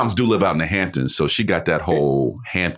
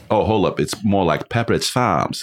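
ordinary talk, nothing marked

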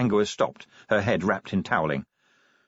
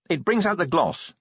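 higher-pitched self-justifying remark; defensive; 9 semitones higher than usual. A narrator's reading. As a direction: add it defensively, pitch lifted. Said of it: "she added defensively".

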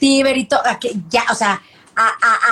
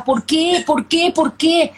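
retold lament; 5 semitones higher, much slower than usual. Retells her lament at the news.

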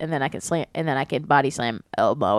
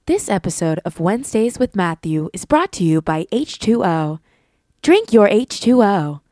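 ad read; 7 dB louder than usual. Acted. Sponsor read on request.

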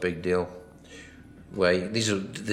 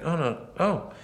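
mock dismay; 9 semitones higher than usual. Reacting to videos copying film.

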